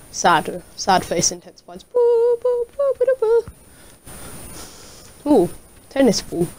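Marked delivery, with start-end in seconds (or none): 1.8-5.3 very high, sing-song boo; high silly boos. Pretend. High tooting boo sounds.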